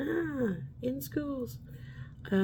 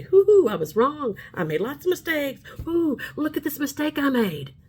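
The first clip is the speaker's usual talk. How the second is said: cheerful confession; 7 semitones higher, 4 dB louder than usual. Pretend. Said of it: "I'm not scared to say".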